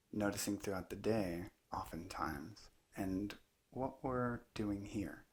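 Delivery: unhurried meditation teacher, long pauses between phrases; calm, measured guidance in short spaced phrases; guided meditation. Acted. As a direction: let each phrase settle into a long silence. Leads a guided meditation talk.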